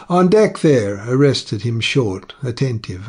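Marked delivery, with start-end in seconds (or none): 0.1-1.0 high, loud calling out; loud hail from the cabin; hail from below. A narrator's reading. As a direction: call up through the skylight. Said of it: "the hail".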